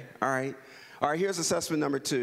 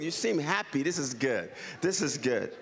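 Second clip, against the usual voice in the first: normal talking voice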